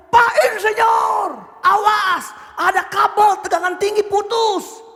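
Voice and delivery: high, drawn-out yell across a distance; shouted warning. Acted. Worker warns the engineer of a live cable, shouting. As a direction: call out an urgent warning from far away. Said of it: "dari pojok sana berteriak".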